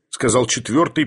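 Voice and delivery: plain reading voice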